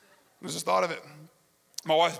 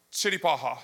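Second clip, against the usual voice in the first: lower, straight-faced delivery; deadpan; 5 semitones lower than usual. Retold or imitated. Flight attendant answers a flood of Russian questions, deadpan. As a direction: say it dead serious, as if it answers everything. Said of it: "dead her in her face".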